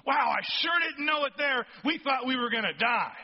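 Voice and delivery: hammed-up shout of relief; amazed exclamation. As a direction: blurt it out like you just realized you survived. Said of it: "Paul's looking back and going".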